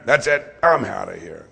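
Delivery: loud, abrupt mock-angry exit line; mad walkout. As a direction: imitate someone storming out mad. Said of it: "If you left mad".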